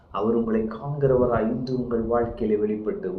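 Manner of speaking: ordinary talk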